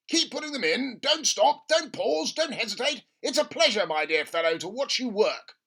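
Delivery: high, loud and gleeful; an overjoyed father egging his son on; giddy dad. Acted. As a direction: cheer the boy on while dancing. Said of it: "dancing around the kitchen".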